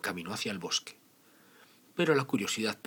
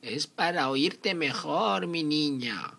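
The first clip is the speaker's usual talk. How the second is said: high, slow granny voice; wolf sweetly faking grandma; fake grandma voice; 7 semitones higher, 4 dB louder, slower than usual. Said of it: "fue la respuesta de la abuelita".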